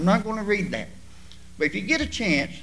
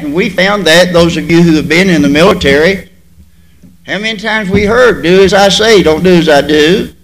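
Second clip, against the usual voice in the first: preaching at full force; 2 semitones lower, 12 dB louder than usual. Real, not acted.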